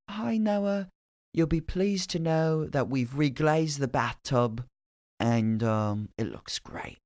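imitating a genteel British landlady; British landlord. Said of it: "she's British, and, and she sounds great".

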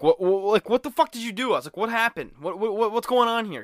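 high, loud, frantic questions; panicked yell. Retold or imitated. Passenger yelling at friend as cops follow, frantic high shout.

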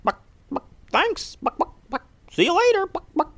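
squeaky chicken asking to play. Acted. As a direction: cluck it like a chirpy hen. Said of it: "the chickens looked to Vanessa and said".

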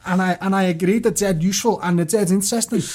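ordinary talk

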